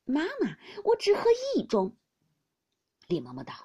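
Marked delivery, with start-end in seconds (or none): 0.1-1.9 put-on higher, louder coaxing boy's plea; pleading. A narrator's reading. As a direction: wheedle the nurse for one cup. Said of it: "宝玉央道". (宝玉撒娇央求)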